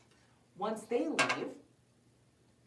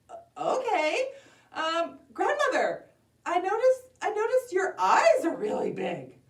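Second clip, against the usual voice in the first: put-on high, hesitant girl voice with stumbles; uneasy little girl; 11 semitones higher, 7 dB louder than usual. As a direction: start unsure, then exclaim high. Girl noticing big eyes.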